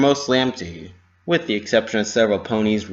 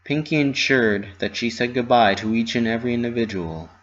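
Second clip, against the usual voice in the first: usual speaking voice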